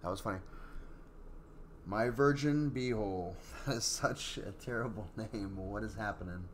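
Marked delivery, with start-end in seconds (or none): none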